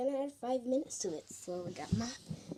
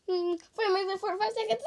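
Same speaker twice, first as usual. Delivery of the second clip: panicked shout; 6 semitones higher, faster than usual. Real, not acted. Panicked timer readout.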